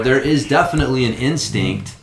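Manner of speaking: ordinary talk, nothing marked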